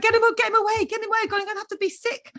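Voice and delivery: high, laughing get-him-away plea; half-laughing, beg them to take the baby; laughing mock plea. New mother to the midwife after birth, laughing mock plea. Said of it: "I was like".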